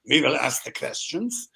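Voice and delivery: bad stage German accent; a clumsy movie-villain German accent; terrible German accent. Actor auditions in a terrible German accent for the casting team. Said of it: "This is my terrible German".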